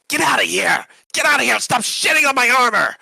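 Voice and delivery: high, loud furious yelling; angry Dragonborn shout. Acted. Dragonborn chasing gnome off her armor, angry shouting. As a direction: chase him off at full shout.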